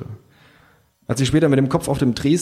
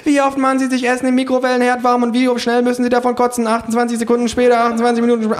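high, rapid-fire questioning; shrill, breakneck survey voice; badgering pollster; 14 semitones higher, 4 dB louder, faster than usual. A narrator's reading. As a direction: rattle off the question fast and very high. Mob of pollsters fires questions.

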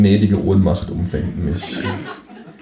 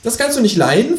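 high, faux-innocent kangaroo voice; falsely innocent; 4 dB louder than usual. A narrator's reading. Kangaroo baiting the narrator, falsely innocent. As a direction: ask in sham innocence, pitched way up. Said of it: "fragt das Känguru scheinheilig".

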